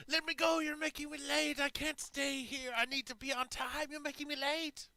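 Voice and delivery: high, loud frantic whine; panicked captive. Acted. Prisoner begging the party to release him, high frantic whine. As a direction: plead in a shrill panic to be let go.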